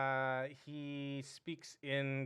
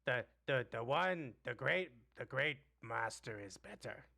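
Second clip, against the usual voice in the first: goblin voice. Acted.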